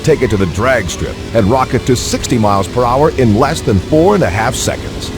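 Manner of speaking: normal talking voice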